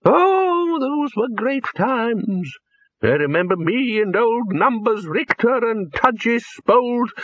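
put-on high old man's voice, swept up in his story; an old man lost in a happy tale; high, slow, excited old voice; rambling old wizard. A narrator's reading. Poons rambles on.

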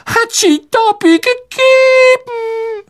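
very high, slow elf voice; verwunderter Hauself. A narrator's reading. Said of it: "sagte der Elf verwundert". (sehr hohe, gedehnte, staunende Elfenstimme)

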